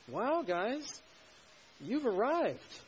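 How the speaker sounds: high, loud fake awe; sarcastic. Preacher paraphrasing Paul to the smug Corinthians, sarcastic. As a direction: congratulate them with heavy mock admiration. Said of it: "You hear the sarcasm?".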